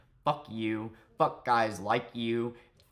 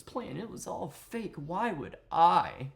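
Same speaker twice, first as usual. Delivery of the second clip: higher, put-on sultry excuse-making; mock seductive voice; 6 semitones higher than usual. Streamer mimicking his rival's fake-plan excuse, mock seductive. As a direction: mimic a would-be seducer protesting. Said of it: "I just can't get over his voice".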